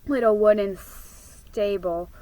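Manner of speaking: ordinary delivery, nothing marked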